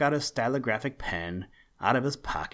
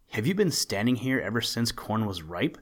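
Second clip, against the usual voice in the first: ordinary delivery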